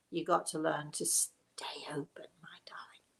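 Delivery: soft intimate whisper fading almost to nothing; tender whisper. Acted. Psychic whispering advice to a viewer, softly fading. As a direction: whisper the advice close and gentle.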